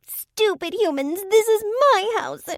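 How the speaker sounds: very high, loud, slow stomping rant; high nasal tantrum. A narrator's reading. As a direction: throw a tantrum like a tiny creature. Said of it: "two octaves higher and painfully nasal".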